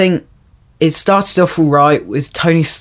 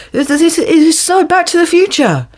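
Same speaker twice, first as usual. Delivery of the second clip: mocking voice; 13 semitones higher, slower than usual. Mocking the film's characters.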